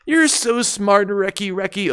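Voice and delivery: high, slow doting grandma. Retold or imitated. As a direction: coo like a proud grandma. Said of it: "Meanwhile, Grandma".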